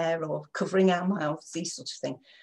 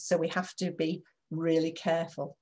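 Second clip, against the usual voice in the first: ordinary talk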